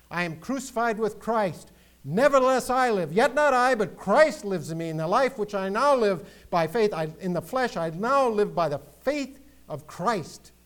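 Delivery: high, loud scripture declamation; ringing pulpit recitation; full-force preaching. Acted. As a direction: proclaim the verse with rising fervour.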